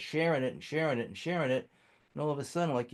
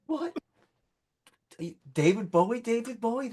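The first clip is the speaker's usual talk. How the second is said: high, incredulous double-take on the name; shocked squeak of a famous name; stunned disbelief; 12 semitones higher, 4 dB louder than usual. Retold or imitated. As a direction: sound like you can't believe who's out there. Retelling meeting [name]'s manager.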